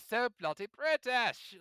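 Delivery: mock British. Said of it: "the other option is there".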